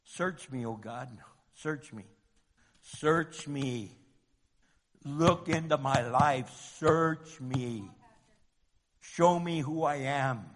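low, soft, unhurried prayer; slow prayer. Shown as a demonstration. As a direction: read it as a quiet prayer, with pauses. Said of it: "let's read it nice and slow. If you really read it like a prayer".

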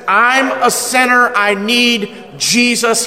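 ordinary delivery

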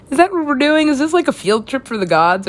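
high, loud excited questions; excited disbelief. Real, not acted. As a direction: burst out giddy.

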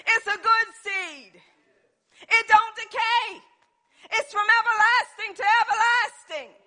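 fired-up preacher in full cry; impassioned preaching. Real, not acted. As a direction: preach it hard to the congregation.

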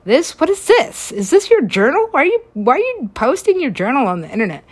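high, loud and fast disbelief; shocked outburst. Real, not acted. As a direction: blurt it out in shock. Shocked at posted journal.

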